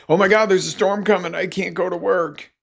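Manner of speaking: fast, high, mock-panicked overreaction. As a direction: gush out a fake alarm, fast and high. Mock panicked worker quote.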